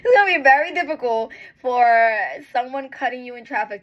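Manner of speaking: real laugh carrying the words. Real, not acted. Laughing while telling viewers about traffic. Laughing through emotional advice.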